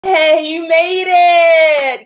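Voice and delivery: high, loud, drawn-out yay; excited greeting. Real, not acted. Vlogger welcoming a viewer to the live stream, high and excited. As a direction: cheer the viewer in as they arrive.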